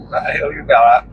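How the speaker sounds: ordinary delivery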